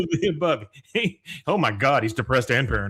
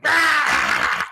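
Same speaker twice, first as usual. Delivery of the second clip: loud high "Ah!" burst; loud high shout-laugh; full force laugh yell; 6 dB louder than usual. Retold or imitated. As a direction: blast it out with everything. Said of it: "it's full force".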